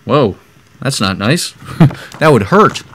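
plain reading voice

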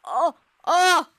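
loud, high frightened gasps; startled gasp. Real, not acted. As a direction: gasp as something jumps out. Sudden scare in dark level.

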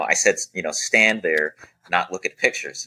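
ordinary talk, nothing marked

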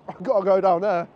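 can't resist, blurt it with a laugh; laughing eager repeat. Retold or imitated. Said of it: "I'm like".

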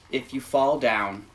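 gentle, measured picture-book read; reading to children. Acted. Reads picture book aloud.

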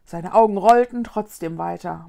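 plain reading voice

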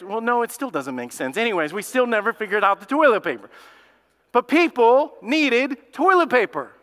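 usual speaking voice

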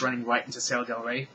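ordinary delivery

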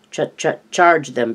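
plain reading voice

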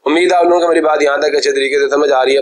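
normal talking voice

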